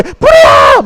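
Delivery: high-pitched fire-alarm cry; acted alarm shout. Teacher acting out someone spotting a fire, high alarm shout. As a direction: yell a warning as if fire broke out. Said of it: "막 소리를 질러요".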